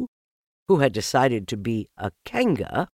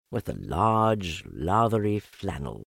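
ordinary delivery

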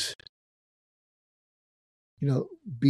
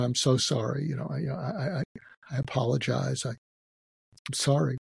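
embarrassed apology; 5 dB quieter than usual. Retold or imitated. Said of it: "And I started apologizing".

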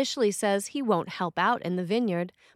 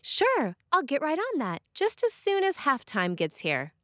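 mock eager promise; 7 semitones higher than usual. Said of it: "and they say".

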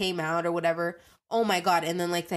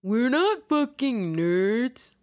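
put-on offended private school kid; 4 semitones higher, 3 dB louder, much slower than usual. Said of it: "they're like".

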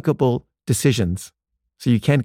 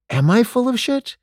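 much higher, anxious self-doubt; upset; 12 semitones higher than usual. Retold or imitated. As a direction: voice leaping up in alarm. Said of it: "I was really upset by this".

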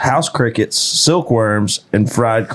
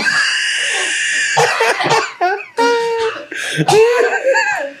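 high, howling laughter. Real, not acted. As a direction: howl with laughter.

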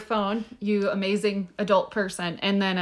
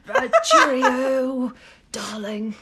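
mock British accent; 3 semitones higher than usual. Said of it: "channel your most British".

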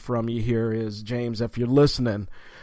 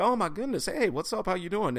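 high, softer retold greeting; mildly flustered fan's hello, pitched up; starstruck; 6 semitones higher, 5 dB quieter than usual. Fan greeting his idol at the urinals, starstruck.

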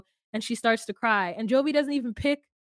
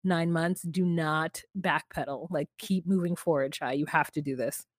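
quiet advice; 6 semitones lower, 4 dB quieter than usual. Retold or imitated. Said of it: "she's like".

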